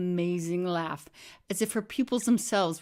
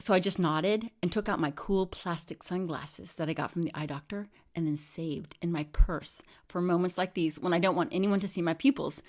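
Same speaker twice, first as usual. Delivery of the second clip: plain reading voice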